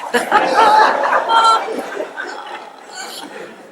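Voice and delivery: high, loud burst of laughter; big laugh. Real, not acted. Teller laughs at his own story, loud and high.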